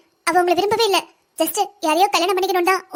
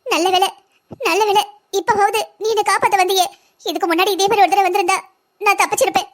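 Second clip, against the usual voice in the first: sobbing relief, acted; crying; 2 semitones higher than usual. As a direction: tearfully thank her for the rescue. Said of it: "இவ ஏன் இந்த நேரத்துக்கு அழுதுகிட்டு இருக்கா?".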